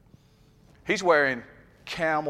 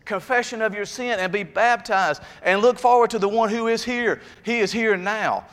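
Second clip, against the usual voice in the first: prophet proclaiming; 7 semitones higher than usual. Retold or imitated. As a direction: call them to repent, line by line. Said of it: "He says".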